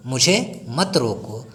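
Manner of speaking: ordinary talk, nothing marked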